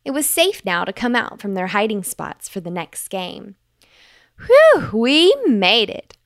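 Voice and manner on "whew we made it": high, loud, slow cheer of relief; happy relieved cheer; relieved joy. Acted. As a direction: celebrate making it safe. Fox relieved after safe run.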